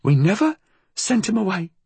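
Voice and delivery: put-on high old-woman voice, insistent; high, emphatic old crone; insistent repeat. A narrator's reading. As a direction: repeat the denial, pitched high. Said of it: "she repeated".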